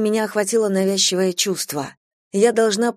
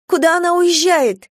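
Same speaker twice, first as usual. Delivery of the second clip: frantic; 11 semitones higher, 6 dB louder than usual. Acted. Girl panics at the van.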